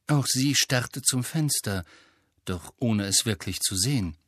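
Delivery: plain reading voice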